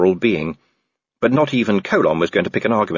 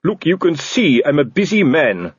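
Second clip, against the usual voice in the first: snapped; 7 semitones higher, 3 dB louder than usual. Acted. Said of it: "snapped Colon".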